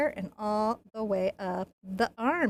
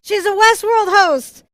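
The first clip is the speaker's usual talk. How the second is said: much higher and louder, slow and delighted; excited reveal; 10 semitones higher, 13 dB louder than usual. Real, not acted. Painter revealing the portrait to viewers, excited announcement. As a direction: announce it like a big discovery.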